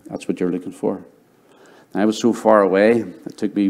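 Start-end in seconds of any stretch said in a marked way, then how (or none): 0.1-1.0 softer echo of God's voice. Retold or imitated. God's inner voice echoed softly to a young Tom. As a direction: drop to a hushed echo.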